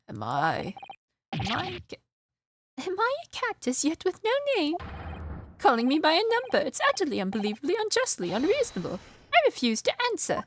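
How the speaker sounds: high, fast indignant prisoner ranting. Acted. Prisoner complains about being called a number, high indignant rant. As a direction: rattle off a rising outraged complaint. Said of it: "I'll probably change the voice later".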